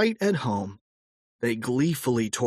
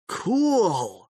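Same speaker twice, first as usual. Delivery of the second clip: exclaimed; 8 semitones higher than usual. A narrator's reading. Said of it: "exclaimed George".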